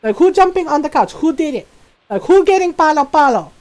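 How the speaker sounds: imitating mom's scolding; fussing mom grilling the kids; angry mom. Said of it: "She's like".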